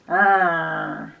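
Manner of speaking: loud growling dinosaur roar; T-Rex roar. Shown as a demonstration. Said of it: "We're gonna roar like a T-Rex".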